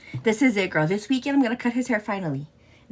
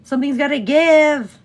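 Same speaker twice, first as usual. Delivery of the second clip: exasperated outburst; 3 semitones higher, 9 dB louder than usual. Real, not acted. Struggling with a task.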